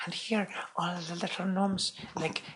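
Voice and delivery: high, soft, halting put-on voice. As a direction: explain it haltingly like a whimsical foreign singer. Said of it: "she was like".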